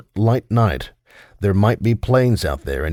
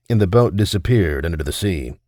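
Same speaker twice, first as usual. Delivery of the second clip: usual speaking voice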